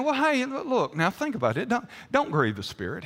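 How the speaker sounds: offhand and hesitant, no force at all; casual suggestion. Shown as a demonstration. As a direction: float it as a mild, hedging suggestion. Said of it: "He's not saying".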